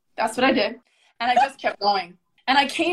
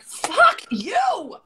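hammed-up outburst, half laughing; mock yell; 8 semitones higher than usual. Telling her coach off in a retold mock yell.

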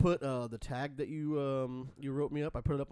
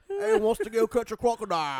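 loud, high, hammed-up Australian accent; Steve Irwin; 8 semitones higher, 11 dB louder than usual. Joking about his holiday party outfit, mock Australian shout. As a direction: shout it like an over-the-top Aussie croc hunter. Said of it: "gonna look like a fat Steve Irwin".

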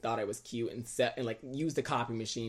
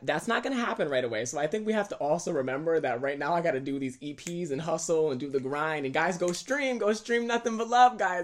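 excited; 5 semitones higher, 9 dB louder than usual. Real, not acted. Hyped plea to stream.

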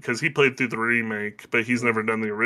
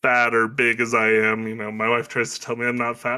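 ordinary delivery

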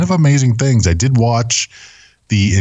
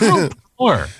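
mock cheer; 11 semitones higher than usual.

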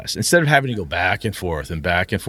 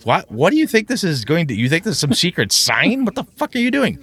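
exasperated disbelief; 7 semitones higher than usual. Retold or imitated. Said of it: "You're like".

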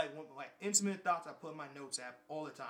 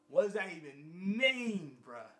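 indignant; 2 semitones higher, 5 dB louder, slower than usual. Retold or imitated. Retold indignation.

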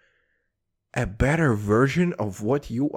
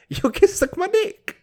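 mock retort; 4 dB louder than usual. Mock neighbor retort, high squeal.

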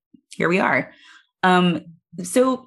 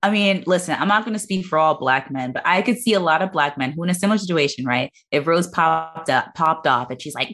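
ordinary talk

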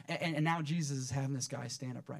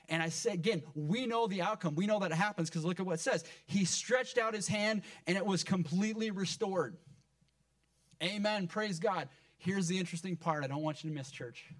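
high, impassioned preacher's delivery; fired-up preaching; 5 semitones higher, 4 dB louder than usual. Real, not acted. Preacher telling church of Jesus confronting his accusers, fired-up preaching. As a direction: preach it fired up, pitch raised.

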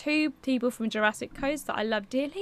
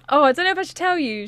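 excited; 4 semitones higher, 9 dB louder, faster than usual. Real, not acted. Dithering over telling secret.

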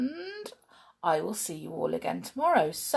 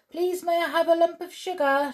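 put-on much higher child calling her mummy; little girl voice; 10 semitones higher than usual. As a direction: sound like a small girl pleading. Sheila calls to mother.

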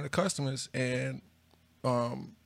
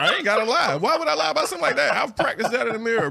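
real laughter breaking through the words, higher and louder; laughing while talking; 9 semitones higher, 7 dB louder than usual. Real, not acted. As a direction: laugh it out while insisting you're serious. Laughing at his own confession.

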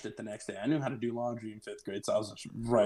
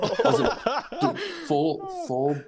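words squeezed out through laughter, high and slow; laughing; 13 semitones higher, 6 dB louder, slower than usual. Real, not acted. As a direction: crack up while saying it. Laughing while telling embarrassing story.

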